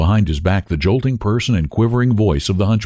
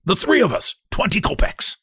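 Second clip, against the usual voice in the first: higher, cracked hunchback call; cracked-voiced hunchback hailing the cab; cracked cry; 11 semitones higher than usual. A narrator's reading. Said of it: "cracked voice".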